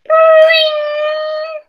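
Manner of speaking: very high, loud cartoon boing; phone-ring cartoon effect; boing sound. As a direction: pop it out like a spring.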